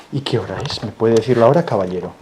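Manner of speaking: loud playful formality; mock formal. Adult playfully asks the child the time, loud mock formal. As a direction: play the posh gentleman's question.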